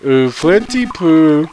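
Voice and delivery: slow, high, breathy fortune teller voice; drawn-out cooing fortune teller; Magypsy impression. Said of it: "That was my Magypsy impression".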